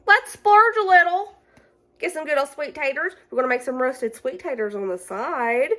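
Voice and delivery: high, bright-spirited treat-yourself voice; excited self-quote. Retold or imitated. To viewers, retelling her own thought at the store, excited. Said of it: "So I was like".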